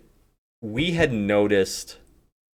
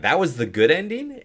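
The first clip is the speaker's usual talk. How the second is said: higher, louder disbelieving question; incredulous; 8 semitones higher, 5 dB louder than usual. Retold or imitated. Player recalling a reaction to a disappointing game ending, incredulous. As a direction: re-enact disbelief, pitch climbing to the end.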